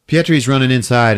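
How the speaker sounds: normal talking voice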